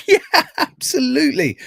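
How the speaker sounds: high laugh into an eager mock agreement; bluffing with a put-on chuckle; pretend laughing agreement. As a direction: laugh along as if you understood. Acting out laughing along, not having understood.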